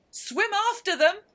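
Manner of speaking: very high, very loud scream; wild scream. Acted. Griffin urging on the dance with a very high, loud scream. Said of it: "screamed the Griffin".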